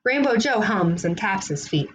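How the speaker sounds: ordinary delivery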